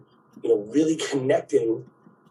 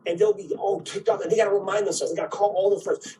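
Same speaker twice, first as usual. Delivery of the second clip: ordinary talk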